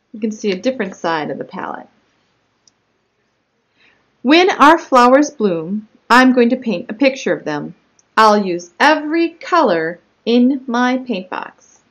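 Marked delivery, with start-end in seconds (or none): 4.2-11.8 animated story narration with punched key words; picture-book read-aloud. Acted. Child narrator telling of her own spot, read as lively story narration. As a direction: read it out clearly and expressively to young children.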